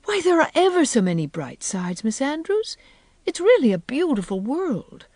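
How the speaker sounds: high, swooping, earnest optimism; eager protest. A narrator's reading. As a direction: plead the bright side with feeling. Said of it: "couldn't endure such heresy in silence".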